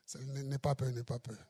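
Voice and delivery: reassuring hush. Real, not acted. Reassuring brother Charlie on stage.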